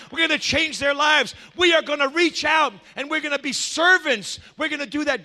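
high, driving sermon delivery; fervent preaching. Real, not acted. Preacher voicing the charge to storm the gates, fervent preaching. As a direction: fire off each resolve like a rallying cry.

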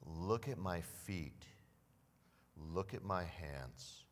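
understated tender Jesus; tender reassurance. Retold or imitated. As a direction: show them gently it's really you. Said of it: "he says this".

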